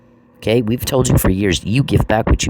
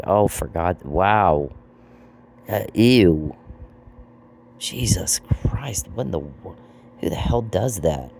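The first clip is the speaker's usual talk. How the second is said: low, trailing-off disbelief; acted-out speechless dismay, petering out; appalled hush; 3 semitones lower, 5 dB quieter, slower than usual. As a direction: mutter it as a stunned, disgusted onlooker. Acting out the onlookers' appalled reaction.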